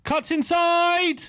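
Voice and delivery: high, stretched alarmed commentary; excited play call. Real, not acted. Commentator watching Grealish attack Spurs, excited high call. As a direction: pitch it up as the attack builds.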